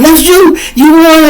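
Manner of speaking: high, loud squealing question; excited onlookers. Retold or imitated. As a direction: crowd shrieking at the winner. People come asking.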